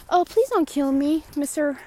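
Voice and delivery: high, loud playful begging; hammed-up frightened plea; mock pleading. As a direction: beg for your life as a joke. Mock plea to an imagined killer.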